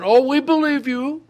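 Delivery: high, airy mocking take on shallow believers; sarcastic high-pitched impression of believers. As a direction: parrot the easy believers. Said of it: "they, they said".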